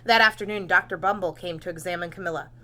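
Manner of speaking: ordinary delivery, nothing marked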